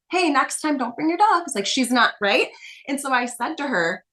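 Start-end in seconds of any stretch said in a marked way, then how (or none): 0.1-1.5 a much higher, meek little ask; lightly voiced timid client, too polite to insist; timid little request. Coach mimics client asking meekly, higher and timid. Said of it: "but she's".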